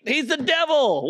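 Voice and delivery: ordinary talk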